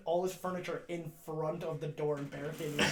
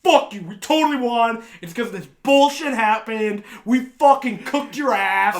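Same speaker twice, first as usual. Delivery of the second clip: loud, higher trash talk; heated, boastful comeback; mock defiant retort; 5 semitones higher, 12 dB louder than usual. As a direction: imitate a friend arguing he totally won. Acts out defiant argument back.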